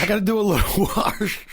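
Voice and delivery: high Boston voice breaking into a laugh; laughing Boston quote. Acted. Comic repeating his Boston line, laughing.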